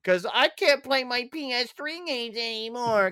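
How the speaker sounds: high, whiny mock sob; mock crying. Mimicking gamers who complain about old games, mock crying. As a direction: whine it like a tearful complainer. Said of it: "they cry".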